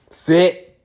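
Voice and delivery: loud, high dog command; firm command. Real, not acted. Owner commanding his dog to sit, loudly. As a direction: bark the order at the dog. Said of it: "that's how you gotta have your dog trained".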